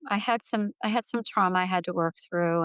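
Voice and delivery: ordinary talk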